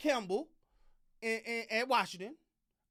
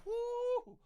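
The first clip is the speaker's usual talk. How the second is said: mock stunned coach, whoo squeaked much higher; imitating stunned coach; 3 semitones higher, slower than usual. Coach reacting to a huge contract offer, mock disbelief. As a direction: act floored by the offer, laughing in disbelief. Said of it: "He gonna have to be like".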